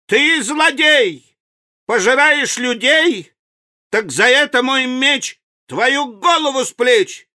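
put-on high, ringing boy hero's threat; heroic challenge. A narrator's reading. Vanya challenges the man-eating crocodile, defiant and high. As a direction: declaim it like a fearless little champion.

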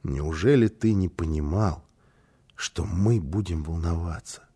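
low, grave fatherly reproach; very serious. A narrator's reading. Father to his son in bed, very serious. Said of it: "папа сказал очень серьёзно".

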